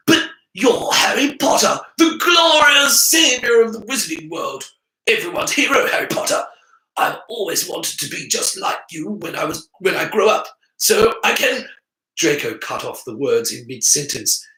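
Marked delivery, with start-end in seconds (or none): 0.1-11.7 high, loud gushing; hero worship. A narrator's reading. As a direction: swoon over your hero. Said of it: "Draco fanboy gush".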